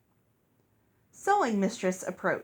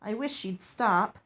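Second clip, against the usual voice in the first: plain reading voice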